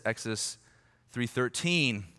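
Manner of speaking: ordinary talk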